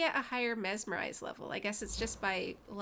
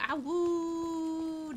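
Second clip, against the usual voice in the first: high drawn-out wolf howl; 5 semitones higher than usual. Pretend. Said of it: "That's a sound that vampires make".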